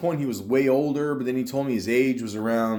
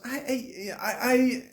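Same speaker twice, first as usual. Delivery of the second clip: put-on a half-started, much higher Indian accent, caught at once; a hint of an Indian accent on the stammer; slipping into Indian accent; 12 semitones higher, 3 dB quieter, slower than usual. Quoting his coworker, a brief slip into an Indian accent. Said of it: "I almost went to an Indian accent there".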